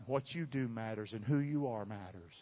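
normal talking voice

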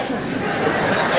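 breathy chuckle under the breath. Real, not acted. Laughs at husband's line.

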